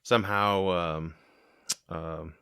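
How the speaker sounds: ordinary talk, nothing marked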